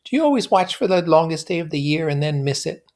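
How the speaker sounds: put-on high, airy young woman; lilting society girl; high voiced Daisy; Daisy's voice. A narrator's reading. Daisy voiced in read novel.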